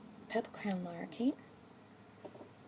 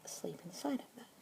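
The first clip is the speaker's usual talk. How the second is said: asmr whisper. Acted. Keeper introducing a budgie to a listener, whispered. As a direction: whisper gently, close to the mic.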